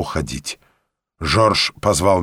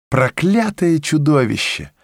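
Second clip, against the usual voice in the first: understated outburst, pitched up; mild mock cry; 6 semitones higher than usual. A narrator's reading. Young woman to her new fiancé, mild outcry. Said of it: "вскричало дитя".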